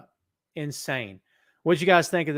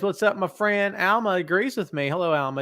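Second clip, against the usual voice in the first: ordinary talk, nothing marked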